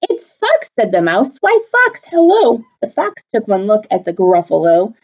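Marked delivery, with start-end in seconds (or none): 0.0-0.7 mouse voice. Acted. 1.4-2.6 mouse voice. Acted. Mouse greets fox.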